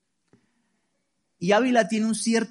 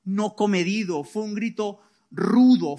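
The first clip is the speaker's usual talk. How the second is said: usual speaking voice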